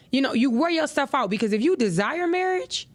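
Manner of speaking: ordinary delivery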